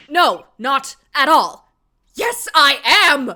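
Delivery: loud flustered reply. Acted. Flustered character denies then admits.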